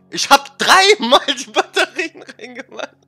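high, slow mocking imitation of her; mocking girlfriend. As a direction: ape her excuse in a high, drawn-out voice. Freundin höhnisch nachgeäfft. (hohes, langsames Nachäffen der Freundin)

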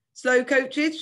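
high, loud taunting yell; cheeky shout. Acted. Girl leaning out of a car yelling at Dad, high loud taunt. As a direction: jeer it out of a car window. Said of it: "she shouted".

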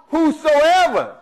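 "whosoever" slow and lifted; stressed whosoever. Shown as a demonstration. Preacher naming the emphasis, slow lifted "whosoever". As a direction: draw out "whosoever" as the point. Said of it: "that's what the emphasis is on".

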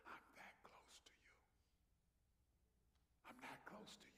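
hushed, intimate whisper; God's whisper. Acted. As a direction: whisper it right into the ear. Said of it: "he can whisper 'cause he says".

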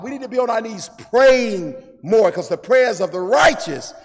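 forceful, rolling exhortation; impassioned preaching. Real, not acted. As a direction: drive it home, leaning hard on key words.

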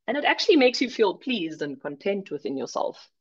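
normal talking voice

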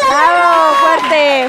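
loud, high cheering; ovación a gritos. Real, not acted. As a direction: shout it out to cheer her on. Celebra que mordió el chile. (gritos agudos de ánimo)

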